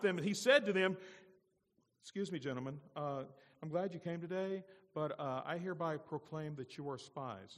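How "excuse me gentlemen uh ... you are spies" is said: calm, courteous mock proclamation in an everyday voice; understated, gentlemanly accusation; mildly polite announcement. Shown as a demonstration. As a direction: deliver the accusation politely, with no force. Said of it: "Is that what that says?".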